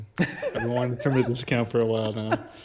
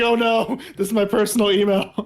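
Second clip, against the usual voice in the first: higher, laughing mock panic; amused, pitched-up oh-no; laughing dismay; 7 semitones higher, 4 dB louder than usual. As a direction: retell your alarm through laughter. Laughing retelling of own alarm.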